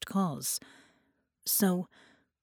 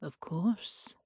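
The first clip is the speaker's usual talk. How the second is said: sinister whisper; 6 dB quieter than usual. Acted. Bela whisper.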